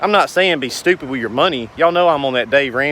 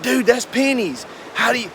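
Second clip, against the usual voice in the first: Grant Cardone voice; 8 semitones higher than usual. Retold or imitated. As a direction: sneer at the salary like a hustle coach. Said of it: "I'm gonna do my Grant Cardone voice".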